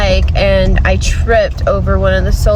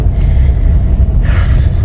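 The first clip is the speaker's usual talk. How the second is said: heavy sigh. Real, not acted. Upset about broken solar panel.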